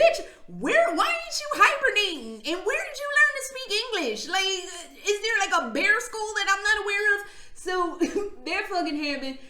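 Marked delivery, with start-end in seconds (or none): 0.0-7.3 high, loud, fast disbelief; incredulous high rant. Retold or imitated. Narrator squeals at the talking bear in mock outrage. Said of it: "I'm like".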